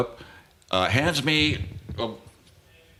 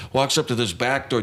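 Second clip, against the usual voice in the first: normal talking voice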